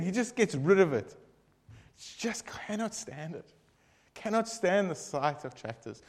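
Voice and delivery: ordinary talk, nothing marked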